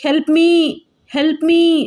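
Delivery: high, loud scream for help. A narrator's reading. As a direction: shout for help in panic. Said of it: "అంటూ గట్టి గట్టిగా అరుస్తున్నాను". (గట్టిగా సాయం కోసం అరుపు)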